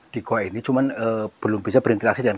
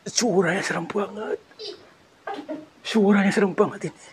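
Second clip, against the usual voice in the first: higher, hushed whisper; scared whisper; 7 semitones higher, 3 dB quieter than usual. Real, not acted. Ghost hunter whispers about the creepy sound, spooked whisper. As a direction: breathe it out, spooked.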